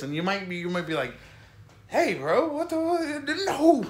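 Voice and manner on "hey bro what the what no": put-on high Florida bro accent; Florida dude voice. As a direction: do a Florida good-ol'-boy accent. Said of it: "I know dudes who talk like this".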